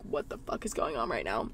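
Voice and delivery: low, soft, flat disbelief; stunned inner voice. Retold or imitated. Lying through an awkward exam, muttering in stunned disbelief. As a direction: mutter it to yourself, deadpan. Inner thought during the ultrasound.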